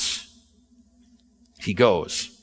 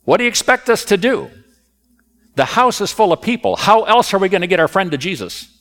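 raised, higher exasperated retort; defensive shout back; 7 semitones higher, 4 dB louder than usual. Retold or imitated. Men on the roof shouting back down, raised and higher. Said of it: "They would have said".

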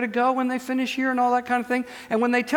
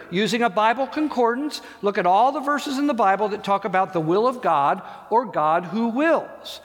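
normal talking voice

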